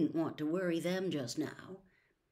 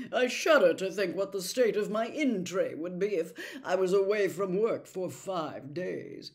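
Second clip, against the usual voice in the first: louder, prim and self-important; sanctimonious; 6 dB louder than usual. A narrator's reading. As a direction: fret about your in tray with righteous airs. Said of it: "said Percy sanctimoniously".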